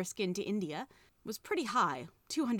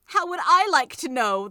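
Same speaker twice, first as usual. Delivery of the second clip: much louder, higher taunt; witch's taunt; 7 semitones higher, 12 dB louder than usual. A narrator's reading. Witch mocking the children before leaving, loud taunt. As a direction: fling it at them scornfully.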